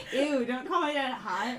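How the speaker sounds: grossed-out protest through giggles; laughing disgust. Real, not acted. Player hearing dad called hot, laughing in disgust.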